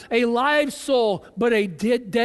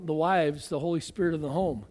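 normal talking voice

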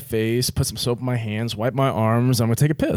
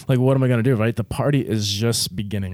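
normal talking voice

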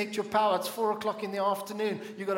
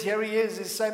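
ordinary talk, nothing marked

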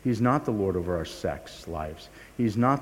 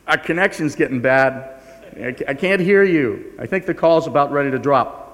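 faking a bad line; 4 semitones higher, 8 dB louder than usual.

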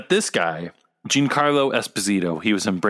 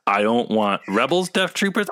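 ordinary talk, nothing marked

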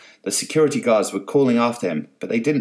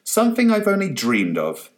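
gleeful; 11 semitones higher, 3 dB louder than usual. Acted. Francis finally rebelling.

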